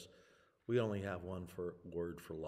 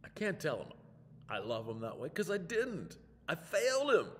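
higher, pained inner voice rising to a cry; anguished confession; 8 semitones higher, 4 dB louder than usual. Acted. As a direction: let the guilt break through at the end.

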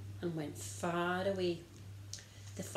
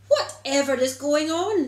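surprised brother; 7 semitones higher, 10 dB louder than usual. Acted. Older boy arrives from work.

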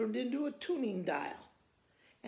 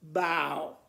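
dog bow; 5 semitones lower, 5 dB louder than usual. Acted. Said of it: "The dog said".

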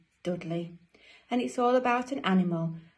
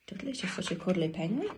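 plain reading voice

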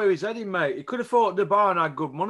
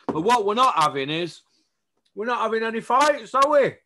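worked-up rant; 6 semitones higher, 4 dB louder than usual. Real, not acted. Ranting about no fights.

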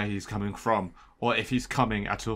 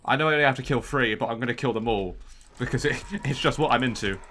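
normal talking voice

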